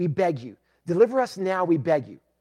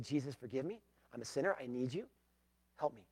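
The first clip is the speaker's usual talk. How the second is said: softer, humble sinner's plea; soft prayer; 5 semitones lower, 14 dB quieter than usual. Shown as a demonstration. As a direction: pray it quietly, as if really asking. Models the sinner's prayer again.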